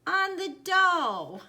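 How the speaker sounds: high and loud, drawing out each word; big, stretched playful emphasis; amused exclamation. A narrator's reading. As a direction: call out the correction slowly, high-pitched. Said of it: "Grandma laughed".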